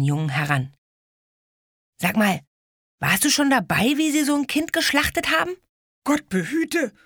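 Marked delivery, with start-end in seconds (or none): 2.0-5.6 challenging. Acted. Ede confronts boy. 6.0-6.9 fearful exclamation. Acted. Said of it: "rief der Junge, schützend".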